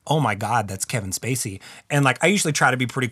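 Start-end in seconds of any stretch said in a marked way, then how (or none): 0.1-1.6 hushed. Retold or imitated. Starstruck inner reaction.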